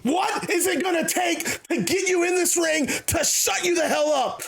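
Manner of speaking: fed up, bellow every word; angry shouting. Retold or imitated. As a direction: Juice shouting at Sakamoto, sick of mind games. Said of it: "And Juice is".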